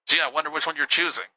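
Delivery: usual speaking voice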